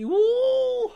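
high squealed ooh. Real, not acted. Fed up with the episode.

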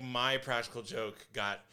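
usual speaking voice

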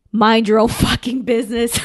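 mock plea cracking up; mock begging, laughing. Kid begging a stranger to back off, played laughing. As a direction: break into laughter mid-line. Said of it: "he's begging, he's begging".

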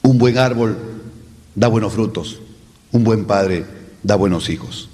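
lines each fall low at the end; descending close to a quote. Shown as a demonstration. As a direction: let every phrase drop down. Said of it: "¿Ascendente o descendente?".

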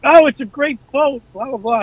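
high-pitched, insincere praise; mock enthusiasm. Officer praising the boat while biting his tongue, mock enthusiasm. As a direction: gush as if covering your real opinion.

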